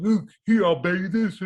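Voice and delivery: hammed-up cocky kid; cocky showoff. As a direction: show off to the girls. His cocky young self showing off.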